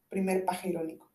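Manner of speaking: normal talking voice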